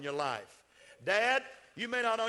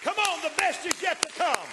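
loud preaching; 11 semitones higher, 8 dB louder than usual. Acted. Repeated climax line.

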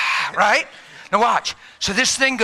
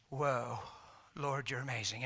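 much softer, lower worshipful murmur; hushed awe; 7 semitones lower, 15 dB quieter than usual. Retold or imitated. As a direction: breathe it reverently.

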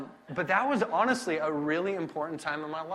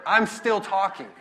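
loud, high, drawn-out punchline; exasperated escalation; 5 semitones higher, 7 dB louder, slower than usual. Retold or imitated. Preacher recounting his endless first message, loud and exasperated. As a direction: build to a slow, laughing, loud disbelief.

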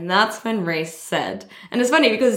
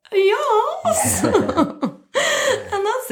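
high tearful laugh, then words stretched out slowly; happy laughter breaking into crying; laughing through tears; much slower than usual. Real, not acted. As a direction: overcome with joy. Laughs and tears recalling yes.

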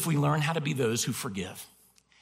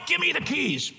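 mock angry demand; 10 semitones higher than usual. Retold angry demand for keys.

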